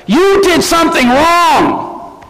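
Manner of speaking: drawn-out indignant mock accusation; crowd's accusation. Preacher voicing the outraged crowd, slow indignant accusation. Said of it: "they didn't say that".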